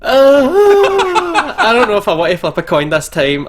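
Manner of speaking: high laughing protest. Real, not acted. Player laughing at coin flip idea, laughing protest. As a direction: laugh through the words.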